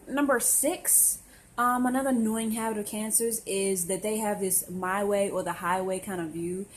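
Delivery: ordinary talk, nothing marked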